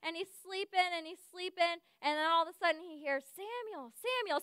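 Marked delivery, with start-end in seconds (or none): 3.2-4.4 soft, higher-pitched calling; mysterious night call. Retold or imitated. The Lord calling sleeping Samuel, soft calling. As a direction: call a sleeper's name softly from afar.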